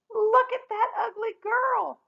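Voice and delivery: high, slow mocking taunt; shrill-free, high-pitched schoolyard jeer; jeering villagers. A narrator's reading. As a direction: sneer at her like a laughing crowd. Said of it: "they laughed".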